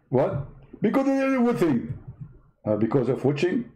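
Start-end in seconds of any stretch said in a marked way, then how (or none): 0.8-2.0 high-pitched mock debater voice; a squeaky, smug rival preacher; Zakir Naik impression. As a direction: push the voice way up and lampoon him.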